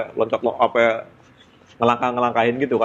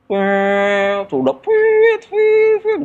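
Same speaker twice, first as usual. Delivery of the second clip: very high piping whistle noise; high, loud whistle sound; imitated train whistle; 13 semitones higher, 4 dB louder, much slower than usual. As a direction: mimic the train pulling away.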